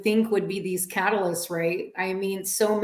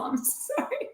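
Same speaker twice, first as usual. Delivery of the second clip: choked up; 5 semitones higher, 8 dB quieter, slower than usual. Real, not acted. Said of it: "It's making me emotional".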